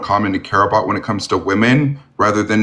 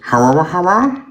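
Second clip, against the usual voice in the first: high, rising wah-wah mumble; Charlie Brown garble; 8 semitones higher than usual. Retold or imitated. Streamer mocking inaudible caller, Charlie Brown garble. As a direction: mimic unintelligible cartoon grown-up babble. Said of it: "I understood everybody in Charlie Brown more".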